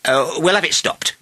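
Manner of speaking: mayor talking with his mouth full. A narrator's reading. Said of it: "his mouth full of royal sausage".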